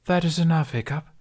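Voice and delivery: a hint of a frowning guardian; firm but mild scolding; firm rebuke. A narrator's reading. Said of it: "Gudrid frowned".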